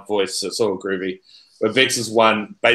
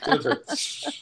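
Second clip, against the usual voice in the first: laughing toast; 8 dB quieter, slower than usual. Pretend.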